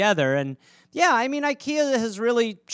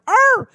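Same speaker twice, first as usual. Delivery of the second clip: high loud yelp of a stepped-on pet; pet squeal; 10 dB louder than usual. Retold or imitated. Stepped-on pet yelping at owner, high loud squeal. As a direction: yelp like a trodden cat. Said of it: "that specific squeal".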